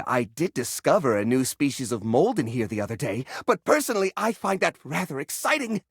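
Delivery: usual speaking voice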